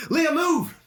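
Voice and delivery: very high, loud cry to move; frantic yell. Acted. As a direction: scream at her to run. Kazlan yells at Leah to run.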